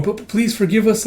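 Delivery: high, stammering plea; apologetic underling. Acted. Beast nervously begs forgiveness.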